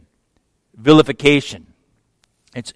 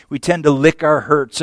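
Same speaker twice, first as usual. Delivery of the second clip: normal talking voice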